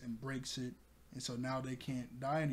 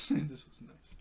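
slower, laughing this was nice; slower than usual. Shown as a demonstration. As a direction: let a chuckle carry it.

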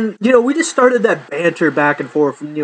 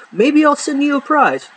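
much higher, playful sing-song dangle; teasing offer; 10 semitones higher than usual. Acted. Promising viewers a prize for jokes, playful high tease.

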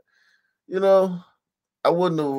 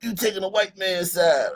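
mocking the dad; 6 semitones higher than usual. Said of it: "He's like".

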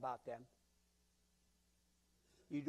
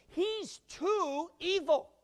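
mock horror; 12 semitones higher, 8 dB louder, much slower than usual. Church doubting Paul's conversion.

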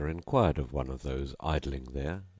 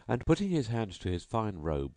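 ordinary delivery

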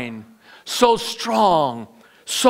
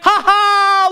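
high, acted "ha ha"; villainous spider laugh; 12 semitones higher, 5 dB louder than usual. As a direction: gloat with a mock spider cackle. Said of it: "laughed the spider".

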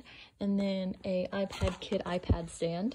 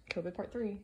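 normal talking voice

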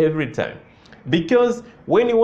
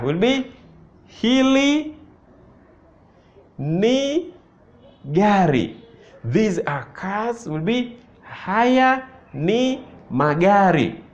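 ordinary talk

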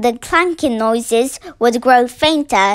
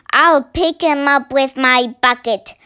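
ordinary delivery, nothing marked

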